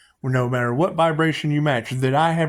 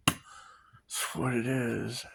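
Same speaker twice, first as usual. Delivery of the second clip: whispered drawl; 3 semitones lower, 11 dB quieter, much slower than usual. Real, not acted. Catchphrase drawn out softly.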